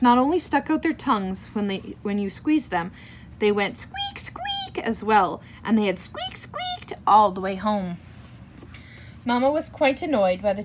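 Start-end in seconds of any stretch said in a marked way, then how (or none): 3.8-4.8 very high squeaked words; toy squeak. A narrator's reading. Reader imitating rubber cats being squeezed, squeaky. 6.0-6.9 high-pitched squeaky imitation; toy squeak. A narrator's reading. Reader imitating toys squeaking all the way home, squeaky.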